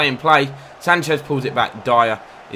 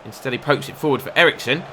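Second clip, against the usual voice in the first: ordinary talk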